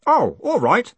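put-on high, loud, carefree boy; breezy acceptance. A narrator's reading. Boy readily accepts the golem's request, breezily. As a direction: agree as if nothing had happened. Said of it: "as if he hadn't been about to brain Moist".

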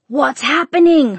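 loud, high, drawn-out shouted question; alarmed father yelling; father's shout. A narrator's reading. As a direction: shout it from another room, stretched out. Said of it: "her father shouted".

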